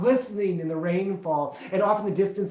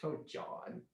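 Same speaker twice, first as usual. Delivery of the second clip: mock sigh; 16 dB quieter than usual. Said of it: "parent's reply to his rant".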